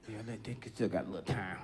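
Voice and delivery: low muttered aside under breath; quiet mutter. Acted.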